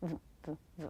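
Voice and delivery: a soft buzzing V barely voiced; just touch a light V; quiet V demo; light V sound. Shown as a demonstration. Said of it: "I make a light V sound".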